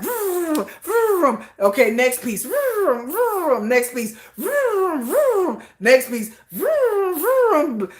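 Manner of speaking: high drill vrooms between brisk next piece calls; cheerful mock builder at work; imitating Jennifer. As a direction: play a busy assembler going vroom, next piece. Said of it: "And Jennifer's like".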